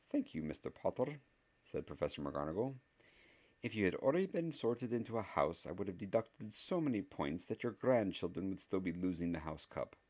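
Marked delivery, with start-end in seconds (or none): 0.1-1.2 higher, dry professor reply; a stern teacher, dryly; dry witch voice. Acted. 3.6-10.0 higher, dryly stern professor; a stern teacher threatening dryly; stern witch voice. Acted.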